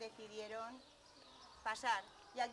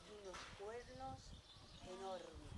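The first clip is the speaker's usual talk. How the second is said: deep for bigness; 3 semitones lower, 7 dB quieter than usual. A narrator's reading. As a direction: drop low and soft on the huge horns. Describing the big goat's huge horns.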